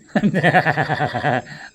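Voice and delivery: big shouted burst of laughter; loud laugh. Real, not acted.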